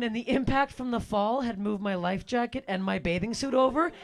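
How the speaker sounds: usual speaking voice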